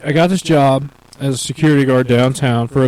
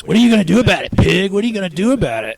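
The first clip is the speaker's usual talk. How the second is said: higher mock taunt; taunting drunk woman; 6 semitones higher, faster than usual. Drunk woman taunting the bike cop, higher mock taunt. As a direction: get in his face and sneer. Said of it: "is getting in his face".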